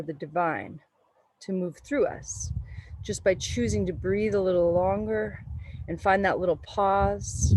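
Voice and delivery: calm, unhurried meditation voice; guided meditation. Acted. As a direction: guide the breath gently. Leads a yoga meditation.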